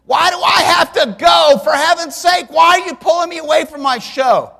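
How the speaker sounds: put-on high, loud childish complaint; boy shouting at his parents about his show; whiny kid protest. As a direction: whine like a seven-year-old pulled from TV.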